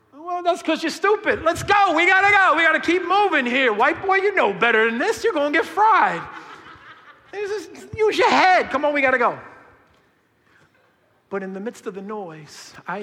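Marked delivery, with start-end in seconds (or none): 0.1-9.4 high, loud herding call; exasperated pastor. Retold or imitated. Pastor scolds and rushes kids at park, loud. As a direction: hurry the kids along, fed up.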